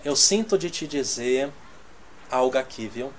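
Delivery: soft, careful way to share a word; gentle humble opening. Shown as a demonstration. Preacher showing the congregation a humble way to begin. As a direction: say it softly and humbly, no pressure. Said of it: "mas você pode começar como?".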